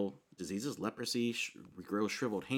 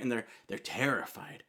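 ordinary talk

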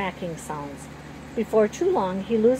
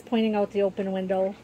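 plain reading voice

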